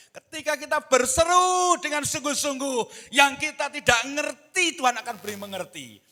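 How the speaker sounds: loud, high, driving pulpit delivery; full-force preaching. Real, not acted. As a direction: press it home at a high shout. (khotbah berapi-api, lantang dan bernada tinggi)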